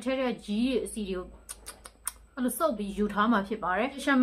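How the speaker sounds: lips framed by mask opening; wearing sheet mask. Real, not acted. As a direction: jaw movement held back.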